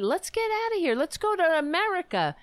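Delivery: high, lilting, poorly faked Irish accent; bad Irish accent. Grandmother urging the family to go to America, bad Irish accent. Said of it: "I can't do an Irish accent".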